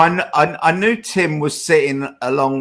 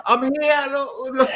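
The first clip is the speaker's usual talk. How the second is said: laughing calling out; 10 semitones higher than usual. Retold or imitated. Waving at a stranger.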